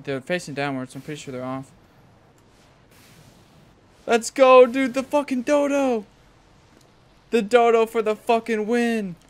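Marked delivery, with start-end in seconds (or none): 4.1-9.2 screaming high, slow celebration; frat bro cheer. Real, not acted. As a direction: yell it out in triumph. Dodo raid worked, cheering.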